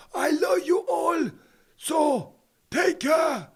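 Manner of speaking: high, slow, loud shouted goodbye; a big drawn-out shouted farewell to the viewers; hammed-up farewell shout. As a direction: bellow the farewell like a showman.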